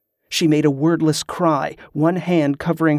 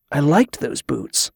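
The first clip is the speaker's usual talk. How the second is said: usual speaking voice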